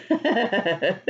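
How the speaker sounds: usual speaking voice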